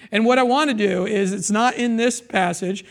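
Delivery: ordinary talk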